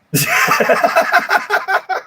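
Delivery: very high, breathless real laugh; helpless high-pitched giggling fit; laughing to tears. Real, not acted. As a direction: laugh till you could cry. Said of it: "I'm gonna cry".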